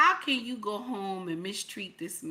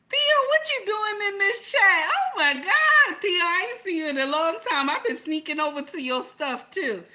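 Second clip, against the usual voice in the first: high, loud delighted shriek of recognition; squealing excited greeting; 10 semitones higher, 6 dB louder than usual. Real, not acted. Greeting a longtime viewer on stream, high-pitched and thrilled. Greets super chat giver Theo.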